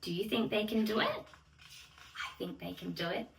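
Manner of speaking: usual speaking voice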